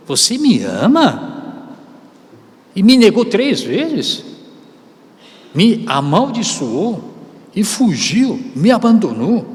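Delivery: mocking reproach. Imagined mocking from Jesus.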